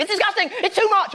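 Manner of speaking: high mock-indignant parent voice; outraged parents. Angry parents objecting to sex education, high mocking outrage. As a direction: squeal it like a scandalised parent. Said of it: "people are very angry".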